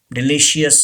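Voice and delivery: DELICIOUS as di-LI-shee-us; wrong pronunciation. Shown as a demonstration. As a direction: add an "ee" before the end of "delicious". (delicious को गलत "डिलीशियस" बोलें)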